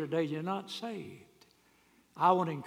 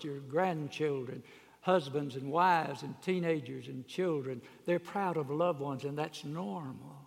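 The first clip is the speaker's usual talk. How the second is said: ordinary delivery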